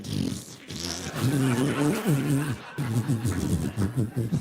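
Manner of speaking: long rising squeaky giggle for a voice; asshole's giggle. Pretend. His asshole answering the message, squeaky rising giggle. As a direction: giggle like a body part answering back. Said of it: "that's how my asshole talks".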